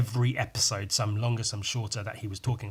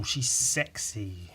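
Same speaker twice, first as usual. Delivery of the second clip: normal talking voice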